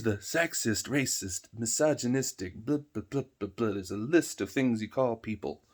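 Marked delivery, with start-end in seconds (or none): none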